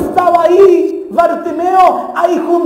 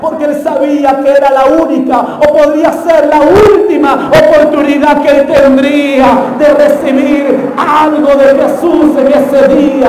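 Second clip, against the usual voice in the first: loud, emphatic sermon climax, slowing toward the end; fervent pulpit proclamation; full-force preaching; 5 dB louder than usual. Real, not acted.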